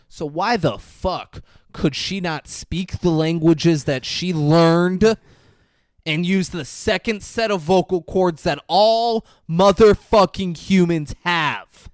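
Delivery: building to a loud, emphatic demand; hammed-up grilling. Prosecutor grilling the priest about languages, mock-angry shout.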